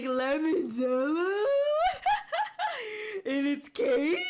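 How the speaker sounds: loud, drawn-out squeal of delight, laughing; giddy disbelief. Retold or imitated. Streamer retelling a bakery find, giddy disbelief. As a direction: stretch it out in amazed, giggly excitement. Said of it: "And I was like".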